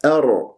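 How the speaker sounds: أرض with a light a and heavy r; light hamza. Shown as a demonstration. Teacher corrects the first sound of أرض, light hamza. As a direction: start أرض thin, then thicken the r. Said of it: "يوجد لدي أ".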